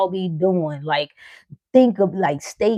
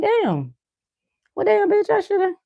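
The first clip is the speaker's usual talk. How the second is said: much higher, squeaky regretful whine; envious regret; 13 semitones higher than usual. Retold or imitated. The girl realizing she should have asked, much higher. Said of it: "And you like".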